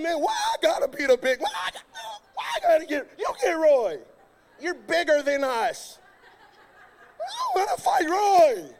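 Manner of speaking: hammed-up scared kid; panicked protest. Retold panicked refusal.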